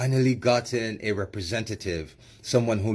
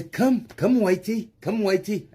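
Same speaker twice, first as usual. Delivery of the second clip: high, coaxing mock South African accent; mocking South African come-hither; 10 semitones higher than usual. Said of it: "I'm doing this with the South African accent".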